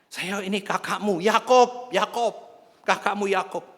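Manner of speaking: high, loud plea to be recognized; urgent self-identification. Acted. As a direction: call out insistently to your brother. Acting returned brother begging recognition.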